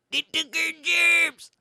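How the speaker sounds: high, put-on caricature yelp; exaggerated mock complaint; hammed-up catchphrase. Joke catchphrase, then apologizes.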